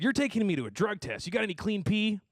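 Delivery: mock girl voice. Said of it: "she said".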